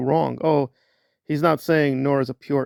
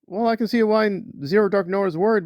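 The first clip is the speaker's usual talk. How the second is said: usual speaking voice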